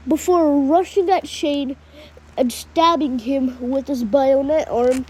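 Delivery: ordinary delivery, nothing marked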